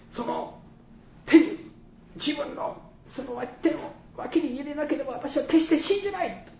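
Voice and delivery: high-pitched, insistent outcry of disbelief; acted cry of refusal. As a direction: act out a doubter crying out his refusal. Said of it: "叫びだったと私は思っています".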